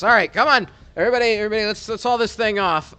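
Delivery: loud, high call to the crew; rally everyone to get moving; brisk shouted order. Acted. Guard calls others to haul creature.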